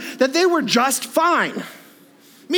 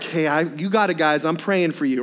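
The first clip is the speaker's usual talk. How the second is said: low, easygoing offhand voice; casual reassurance; 8 semitones lower than usual. Retold or imitated. Jesus imagined calling down to the panicking disciples, low and casual. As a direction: brush it off gently like a laid-back friend.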